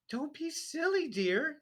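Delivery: high, prim wifely voice; a fussy wife brushing him off; wife's voice. A narrator's reading. Wife dismissing husband's hat trouble, high and prim. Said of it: "his wife said".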